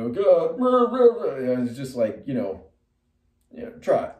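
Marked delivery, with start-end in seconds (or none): 0.1-1.5 mock angry ranting. Mocking an angry ranter.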